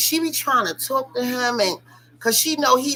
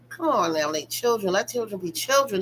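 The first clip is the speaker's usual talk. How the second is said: ordinary talk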